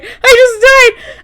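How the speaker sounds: high, drawn-out squeal of amusement; dying of laughter. Real, not acted. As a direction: squeal it slowly, helpless with amusement. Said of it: "And I just died".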